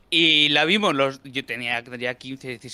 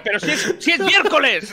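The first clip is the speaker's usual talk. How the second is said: riendo; 10 semitones higher, 4 dB louder than usual. Retold or imitated. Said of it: "Claro, decía".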